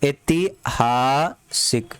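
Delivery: ऐतिहासिक stretched very slowly, every syllable held; slow drill. Shown as a demonstration. Teacher slowly drilling ऐतिहासिक for the students. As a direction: spell ऐतिहासिक out slowly for the class. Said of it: "क्या होता है?".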